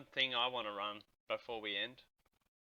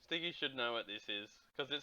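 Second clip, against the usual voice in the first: softer; 8 dB quieter than usual. Real, not acted. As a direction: say it again softly, to himself.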